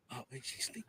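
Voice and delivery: barely audible hushed whisper; imitated whisper. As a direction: whisper it like a kid beside a sleeping parent. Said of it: "he was just whispering".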